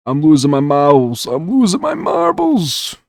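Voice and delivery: mock frantic high-pitched wail; silly high voice. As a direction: squeak it out like a cartoon going crazy. Silly self-mockery while building.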